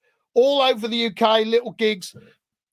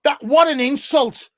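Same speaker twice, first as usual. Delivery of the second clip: loud, higher, hammed-up offence at the insult; mock outrage; 5 semitones higher, 5 dB louder than usual. Guest playing insulted at the host's joke, mock outraged. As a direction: pretend to be deeply wounded by the host's remark.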